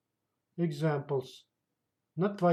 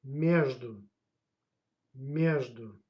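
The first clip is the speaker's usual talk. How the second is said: "Между" as MEZH-du, falling at the end; stress on first syllable; 3 semitones lower, slower than usual. Shown as a demonstration. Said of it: "The stress goes on a first syllable".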